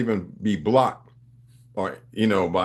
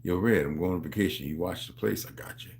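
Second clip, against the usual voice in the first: hushed quote; 6 semitones lower, 7 dB quieter than usual. Retold or imitated. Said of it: "the first thing they come to me".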